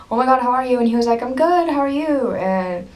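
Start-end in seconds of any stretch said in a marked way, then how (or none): 0.1-0.8 high, loud retold fangirl hello; flustered greeting. Fan greeting a band member, flustered and excited. 1.2-2.3 high, lilting imitation of a cheery answer; friendly reply. Band member answering a fan, retold as friendly.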